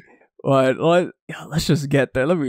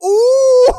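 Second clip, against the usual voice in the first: loud thrilled whoop; 6 dB louder than usual. Real, not acted.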